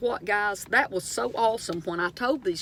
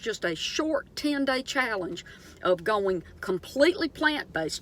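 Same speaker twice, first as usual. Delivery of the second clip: usual speaking voice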